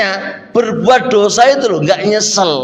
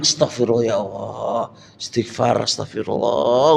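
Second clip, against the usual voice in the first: low, soft repentant murmur of istighfar; pious murmur; 9 semitones lower, 6 dB quieter than usual. Acted. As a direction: murmur the prayer as if truly sorry. Voicing a living heart's repentance.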